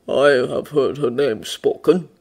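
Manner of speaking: slow, even bear voice; measured bear. A narrator's reading. Bear answers the man about the witch, measured.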